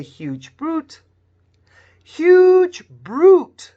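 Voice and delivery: "huge brute" con la u larga bien marcada; huge brute, long u. Shown as a demonstration. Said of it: "you say".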